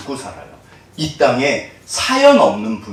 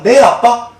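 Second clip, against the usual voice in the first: put-on young Joseph's bright reply, higher and louder; a son's quick, willing "yes, dad" to his father; eager boy answering; 7 semitones higher, 7 dB louder, much slower than usual.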